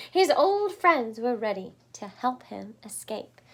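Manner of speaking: plain reading voice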